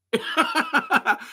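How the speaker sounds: high-pitched giggling laugh; laughter. Real, not acted. Amused by why they had the accent, high laughter.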